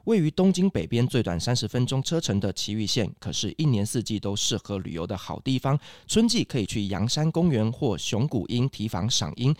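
smooth sponsored ad voiceover; ad read. Acted. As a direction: read it like a radio sponsor spot.

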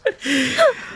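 very high rising giggle; squealing laugh. Real, not acted. Laughing at funny quote.

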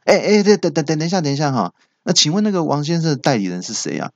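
high, flustered rush to stop the transfer; hurried. Retold or imitated. Caller rushing to stop the operator's transfer, high and flustered. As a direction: blurt it out before they hang up. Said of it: "我就急急忙忙就说".

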